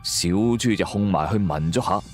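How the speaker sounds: normal talking voice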